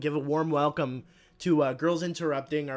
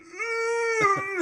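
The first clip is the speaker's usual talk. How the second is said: mock walrus wail; 6 dB louder than usual. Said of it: "he's like".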